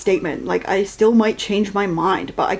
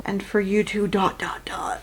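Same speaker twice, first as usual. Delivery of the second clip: dot, dot, dot whispered away; teasing whisper; 5 dB quieter than usual. Retold or imitated. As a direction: whisper the last words. Teasing quote trailing off.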